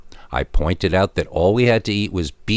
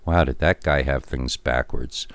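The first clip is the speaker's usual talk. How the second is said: ordinary delivery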